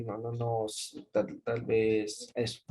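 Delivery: soft, halting, trailing off; unsure faltering. Shown as a demonstration. Teacher acting a doubtful person, soft and halting. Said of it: "cuando una persona empieza a estar".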